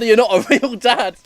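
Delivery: mock cry at the child's dad; shouting. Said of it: "cried Ricardo at the top of his vocal cords".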